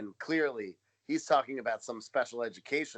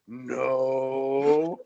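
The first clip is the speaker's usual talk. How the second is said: flat, blunt one-word denial; the bodyguard's deadpan no; 5 dB louder than usual. Retold or imitated. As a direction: drop a dead flat no. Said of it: "it was exactly the same tone".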